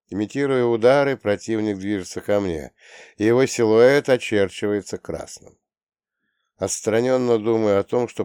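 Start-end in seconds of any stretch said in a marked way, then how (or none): none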